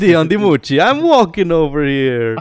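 loud, high New Jersey tough guy; New Jersey accent. Acted. Mocking a singer as a Jersey lout, hammed-up New Jersey accent. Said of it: "E un cocalat de New Jersey".

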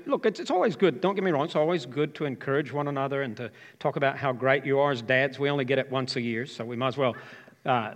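ordinary talk

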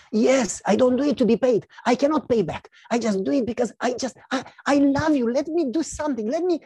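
high, insistent, stumbling plea; earnest pleading. Acted. Grateful friend begs the neighbor to let him help, insistent.

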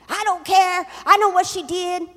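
angry retort. Retold or imitated. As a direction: play someone refusing to calm down. Retold angry woman answers back.

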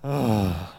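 low, drawn-out sighing "oh"; weary sigh. Acted. Preacher shows a discouraged reaction to endless battles, weary sigh. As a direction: let out a heavy, deflated groan. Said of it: "makes you just go".

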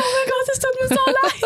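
hoch und lachend die Frau nachmachen; lachend nachgeahmt. Retold or imitated. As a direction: gespielte Entschuldigung unter Lachen. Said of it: "Und dann hat sie so". (Frau lachend nachgeahmt, viel höher)

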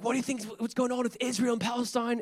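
high-pitched throaty Australian neighbor impression; Australian throat voice. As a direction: mimic an Aussie bloke talking from his throat. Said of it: "he had, like, a throat voice".

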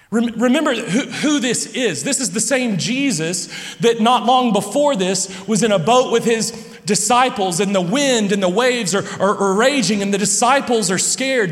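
animated full-force preacher; fervent preaching. Real, not acted. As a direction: preach it with rising energy, a little laughing. Preaching the storm story at full force.

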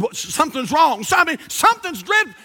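high, overdramatic worried parent; hammed-up alarm; mock panic. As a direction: pretend to panic on an emergency call. Imagined panicked call to emergency room.

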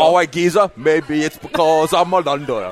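put-on copied London accent, drawn out at the end; London accent attempt. Host copying the owner's demo, London accent. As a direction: imitate a Cockney Londoner. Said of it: "yeah, you get it".

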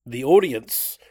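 Philly-style audience, vowels mushed; Philadelphia accent. Retold or imitated. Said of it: "kinda sounds like a, a Philadelphia thing".

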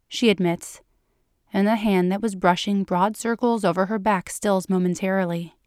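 plain reading voice

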